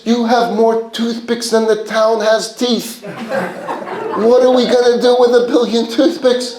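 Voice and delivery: high and incredulous scolding; father mocking his son's foolish deal; ridiculing father. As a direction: berate the son in disbelief. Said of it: "he's being ridiculed by his father".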